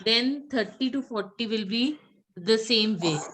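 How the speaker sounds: usual speaking voice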